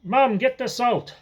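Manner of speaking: mock call to the house, pitched high. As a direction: shout the order to Mum, drawn out. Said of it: "he starts calling out".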